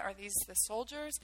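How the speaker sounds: usual speaking voice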